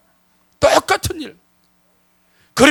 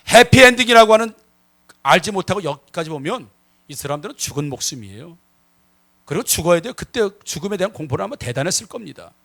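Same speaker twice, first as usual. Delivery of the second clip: usual speaking voice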